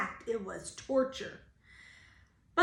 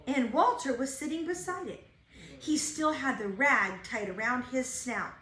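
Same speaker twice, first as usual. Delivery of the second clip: plain reading voice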